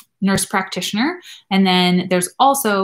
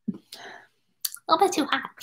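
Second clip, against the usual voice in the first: "A little bit too hot" repeated much higher, slow and soft; silly high repeat; 10 semitones higher, 6 dB quieter, slower than usual. Pretend. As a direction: squeak it out slowly like a cartoon.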